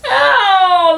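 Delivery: put-on high, long little-boy yowl; loud cry of pain. Little boy swiped by cat, crying out. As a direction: yelp as if scratched. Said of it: "the little boy cries".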